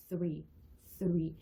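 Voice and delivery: THREE repeated low with an unvoiced th; voiceless TH. Shown as a demonstration. Teacher repeats three for learners, voiceless TH. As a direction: start "three" on a voiceless tongue-between-teeth th. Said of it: "요 무성음의 발음이 되겠죠".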